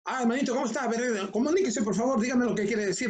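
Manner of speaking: mock rushed chatter tumbling into blah-blah; rapid choleric talker. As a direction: imitate someone talking far too fast. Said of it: "que hablan muy rápido".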